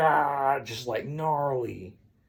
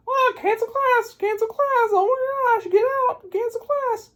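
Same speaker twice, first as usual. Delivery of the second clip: very high, loud squealed alarm; panicked teacher; 5 dB louder than usual. Retold or imitated. Teacher clearing out the classroom, hammed-up panicked squeal. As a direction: shriek the orders in mock panic.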